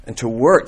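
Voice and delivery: "work" punched; a short phrase with "work" stressed. Shown as a demonstration. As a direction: hit the word "work" in "And to work". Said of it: "emphasize a different word each time".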